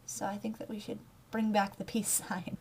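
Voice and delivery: whisper. Real, not acted. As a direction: whisper it like a little secret. Playful aside about peace sign.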